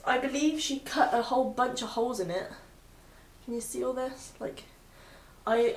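usual speaking voice

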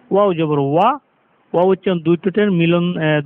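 usual speaking voice

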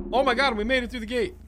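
high, loud burst of relief; yelling with relief at making it through; relieved shout. Real, not acted. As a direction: cheer out the escape. Escaping through the gate.

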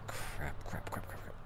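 hushed repeated curse, barely voiced; frantic whispered cursing; whispered panic. Panicked muttering.

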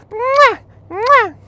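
high, squeaky "mwah"; loud blown kisses. Pretend. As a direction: smack out exaggerated kisses. Blows kisses at truck.

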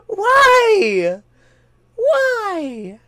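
laughing cry of disbelief; half-laugh, half-wail; despairing laugh. Real, not acted. Laugh-cry after getting caught.

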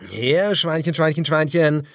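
creepy villain calling piggy. A narrator's reading. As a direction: lure the prey out mockingly. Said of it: "rief der Anführer".

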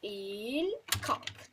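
"Eğil kalk" said very slowly, drawn out; slowed explanation. Shown as a demonstration. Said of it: "Taktik bu gençlik".

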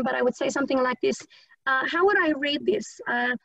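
ordinary talk, nothing marked

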